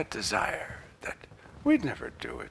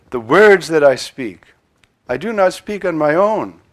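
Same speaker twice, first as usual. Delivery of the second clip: solemn loud reading; 3 semitones higher, 12 dB louder than usual. Acted. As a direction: proclaim each word. Reads gospel loudly.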